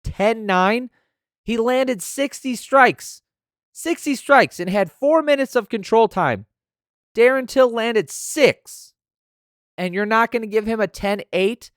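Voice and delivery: high, loud, slow outraged yell; furious yelling. Real, not acted. Fan raging at the judges over the score, yelling. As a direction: spit out the numbers in disbelief.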